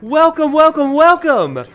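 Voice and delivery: high, loud, whooping welcome; excited greeting. Real, not acted. Streamer hails an old streamer friend arriving, excited. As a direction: call out a friend's name, drawing out the big hello.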